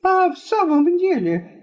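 perking up. A narrator's reading. Guest Жоржик asks the wife, perking up. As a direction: come alive with interest. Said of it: "оживляясь".

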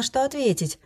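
ordinary delivery

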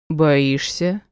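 lightly joking question; understated banter; playful. A narrator's reading. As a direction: tease with a mild playful query. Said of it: "шутливо уточнил Эдгар".

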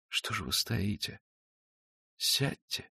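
very soft, slow, gentle invitation; hushed, caressing voice; quiet and tender. A narrator's reading. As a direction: drop almost to a whisper, kindly. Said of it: "тихим и ласковым голосом".

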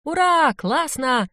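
high, excited crowd cheer; high-pitched burst of glee; lively cheer. A narrator's reading. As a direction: cheer as the party gets going. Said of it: "оживились все вокруг и зааплодировали".

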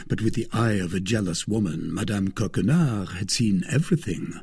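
ordinary delivery, nothing marked

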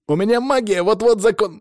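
high, urgent cry; panicky protest. Acted. Alexei warns Sanya his magic is nearly gone, panicky. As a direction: protest in alarm until cut off.

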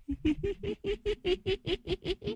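high piercing cackle, thin and soft; an eerie spirit's titter; shrill ghostly cackle. Acted. Possessed woman cackles shrilly at the exorcist. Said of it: "cười the thé".

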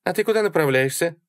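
quick, high female voice; high-pitched eager question; inquisitive Sally. A narrator's reading. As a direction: ask the driver brightly. Sally chats up new driver.

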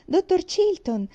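happy greeting call. A narrator's reading. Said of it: "felice lo salutò".

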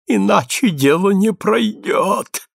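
slow, high, strained utterance; squeezed out, gasping. A narrator's reading. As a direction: force it out as if short of breath. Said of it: "выдавил Кобис, задыхаясь". (выдавливая слова, задыхаясь)

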